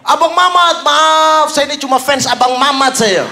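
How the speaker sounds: retold yelling. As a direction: imitate a fan yelling after someone leaving. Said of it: "tiba-tiba dia teriak".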